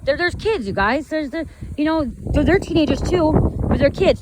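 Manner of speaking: stern yelling. Retold or imitated. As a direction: scold the teen drivers firmly. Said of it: "he was stern".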